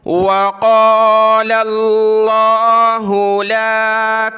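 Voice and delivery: slow melodic tilawat; Quranic recitation. Acted. Teacher recites the whole verse for students. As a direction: chant the verse slowly and evenly.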